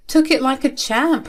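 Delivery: usual speaking voice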